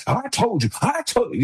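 the kind of parent he says he isn't, a high nag; mock angry parent. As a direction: pitch it up like a nagging parent, then drop it. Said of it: "I'm not yelling at them".

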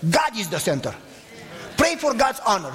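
ordinary delivery

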